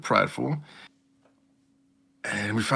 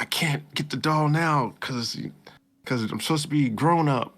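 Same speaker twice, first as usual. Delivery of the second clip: put-on reluctant girl; 7 semitones higher than usual. Heroine voiced reluctantly.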